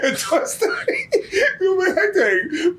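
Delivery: laughing. Real, not acted. Cracking up at the email.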